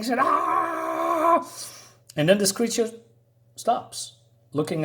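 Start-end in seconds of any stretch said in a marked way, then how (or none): 0.2-1.5 high-pitched imitated battle cry; mock yell. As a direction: let out a sudden high "Ah!" like charging with a spear. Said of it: "So he's yelling".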